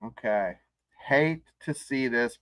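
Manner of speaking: normal talking voice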